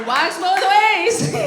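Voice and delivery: slow, loud, drawn-out call; loud, slow proclamation; loud punchline. Real, not acted. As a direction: shout it out over the laughter, stretching each word. Punchline called out over laughter.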